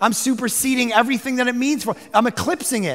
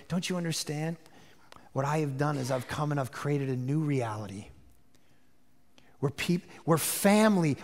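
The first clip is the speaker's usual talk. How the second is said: tender, hushed; 6 semitones lower, 5 dB quieter than usual. Acted. Jesus telling the woman there are no holy lands, soft and tender. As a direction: speak it softly like a revelation.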